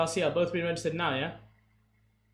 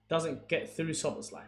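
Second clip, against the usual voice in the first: ordinary delivery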